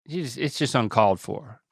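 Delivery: usual speaking voice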